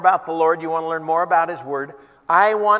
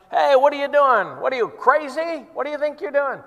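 mocking heckle; 8 semitones higher than usual. Crowd jeering at the man on the ladder, mocking. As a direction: taunt him like a lunchtime crowd. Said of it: "People started mocking him".

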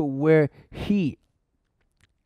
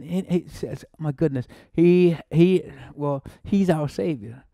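ordinary talk, nothing marked

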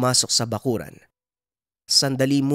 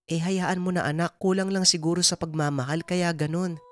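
higher-pitched mother gently brushing off a worry; Nanay Dolor, reassuring mother; 9 semitones higher than usual. Acted. Mother consoles her son and asks for help, reassuring.

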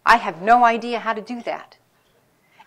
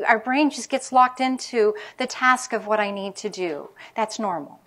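ordinary delivery

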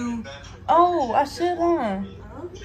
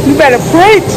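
hype; 4 semitones higher, 14 dB louder than usual. Real, not acted. Hyping up a friend.

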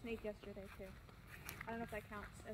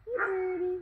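pet talk; 7 semitones higher, 11 dB louder, slower than usual. Real, not acted. Greets the bird.

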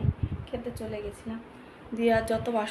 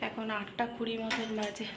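usual speaking voice

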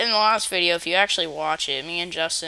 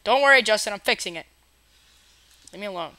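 ordinary delivery

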